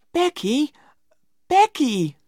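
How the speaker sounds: high-pitched pleading call; anxious pleading. A narrator's reading. Tom pleads with crying Becky.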